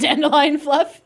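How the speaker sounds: giggling through a playful line; bubbling laughter on the words; laughing. Pretend. Jokes back the line.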